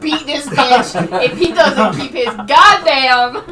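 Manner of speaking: loud, drawn-out mock rage; hammed-up furious shout; threatening outburst. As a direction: belt out an exasperated threat. Acted threat at companion's eye roll.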